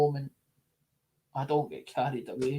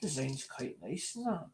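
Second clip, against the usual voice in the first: much softer, musing on the design; quiet musing; 11 dB quieter than usual. Retold or imitated. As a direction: murmur it while inspecting the back. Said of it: "turns it over and goes".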